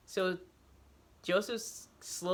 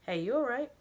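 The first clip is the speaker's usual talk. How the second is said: higher, louder caring check-in; concerned voice; 5 semitones higher, 3 dB louder than usual. A narrator's reading. Stranger checking on Joseph, concerned call. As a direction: call him back gently but urgently. An indistinct voice asks.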